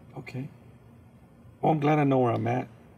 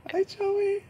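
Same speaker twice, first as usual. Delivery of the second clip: high mock greeting. Passer-by greets through door.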